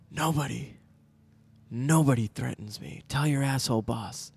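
a lightly voiced Seagal menace; Seagal impression. Mock Seagal to a henchman, low hushed threat. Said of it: "that's what he says that way".

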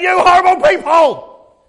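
loud, high furious shout; angry shout. Pretend. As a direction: yell it at them in outrage. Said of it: "I'd say".

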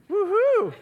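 high, loud imitated cheer; gleeful mock woohoo; joyful child's whoop. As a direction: whoop like a thrilled little girl. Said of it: "Like, joy".

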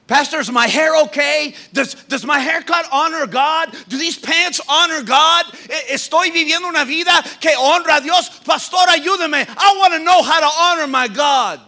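high, loud and urgent; eager youth pleading. Acted. Preacher voicing an eager teen asking the pastor, high and urgent. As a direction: ask like a kid desperate to please God.

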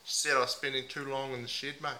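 a lightly put-on Aussie bloke accent, mate on every line. Host playing a heartbroken meth packer in a mild put-on Aussie accent. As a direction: ham up the ocker Aussie, leaning on mate. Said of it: "put on a few different voices".

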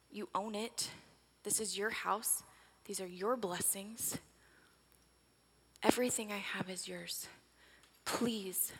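soft, hushed reassurance; gentle, not yelling. Acted. Mother gently pleading with her angry daughter, hushed. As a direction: coax her in with a near whisper. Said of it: "I don't accuse her or yell".

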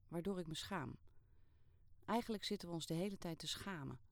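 ordinary delivery, nothing marked

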